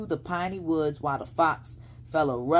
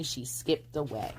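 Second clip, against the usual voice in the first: ordinary delivery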